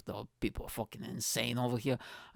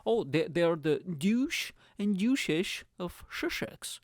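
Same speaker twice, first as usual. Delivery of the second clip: slow, higher sneering posh drawl; hammed-up upper-class sneer; mock posh voice; 5 semitones higher, slower than usual. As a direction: put on a snooty aristocrat. Mocking posh royals.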